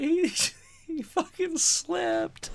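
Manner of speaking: high, slow laughing words; high giggling drawn-out line. Retold or imitated. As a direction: laugh it out slowly. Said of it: "I'm just laughing".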